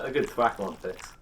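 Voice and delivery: usual speaking voice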